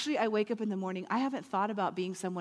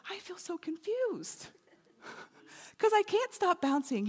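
high, drawn-out bewildered whine; confused Tigger; 8 semitones higher than usual. Acted. Tigger walking away from the purple one, high and confused. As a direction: play a lost, bouncy character, pitched high. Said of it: "the Tigger walks away going".

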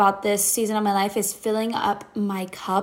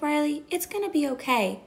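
lightly voiced toddler; 5 semitones higher, 5 dB quieter than usual. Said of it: "she was two".

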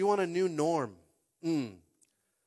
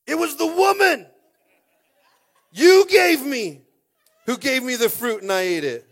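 Adam's whiny excuse; 11 semitones higher, 14 dB louder than usual. Acted. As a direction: whine the blame onto the woman. Adam blames the woman.

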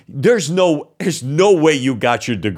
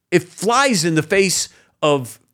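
usual speaking voice

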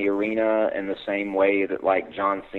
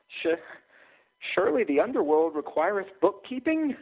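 higher, nervous plea; delirious plea; 11 semitones higher than usual. Acted. Clerk begging the dragon for a bookkeeping job, higher. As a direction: babble it in a panic. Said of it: "I spoke with delirium".

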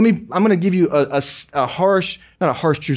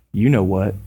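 low, dry punchline; deadpan parrot; 7 semitones lower than usual. Retold or imitated. Parrot to the customer it insulted before, smugly deadpan. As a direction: drop the pitch and deliver it deadpan.

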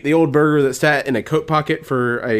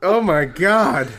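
laughing; 3 semitones higher, much slower than usual. Real, not acted. Laughing disbelief.

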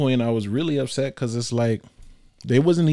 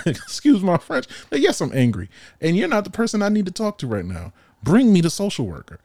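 heated outburst; 8 semitones higher, 4 dB louder than usual. Retold or imitated.

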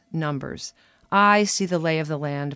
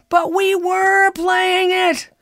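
high, loud, slow drawn-out moan; long high despairing moan; 8 dB louder, much slower than usual. Retold or imitated. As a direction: wail the complaint slowly. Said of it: "I moaned".

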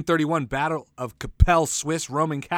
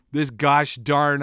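normal talking voice

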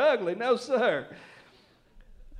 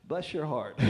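"bless your heart" dropped low and soft as a put-down; "bless your heart" muttered low with a sting; insulting bless your heart; 7 semitones lower, 6 dB quieter than usual. Shown as a demonstration. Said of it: "it can be an insult".

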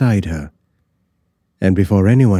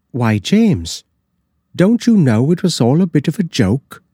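higher, louder cook, amused; the cook explains the prank; cook's voice; 9 semitones higher, 3 dB louder than usual. A narrator's reading. Cook teases James, in a higher, louder voice. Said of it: "the cook said".

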